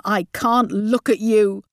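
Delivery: slow, emphatic disgust; roared. A narrator's reading. Mum rejecting her daughter, slow angry emphasis. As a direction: draw out each word in anger. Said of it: "roared Mum".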